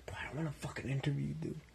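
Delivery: fast annoyed whisper. Acted. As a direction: hiss it at him quickly. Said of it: "unvoiced whisper".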